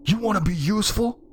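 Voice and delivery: high, heated challenge; fed-up, high-pitched outburst; angry yell. A narrator's reading. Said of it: "I yelled".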